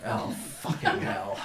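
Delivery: slow, breath-heavy cursing; appalled groan. Acted. Burden looks out at the approaching carts, slow appalled groan. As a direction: curse under your breath, dragged out.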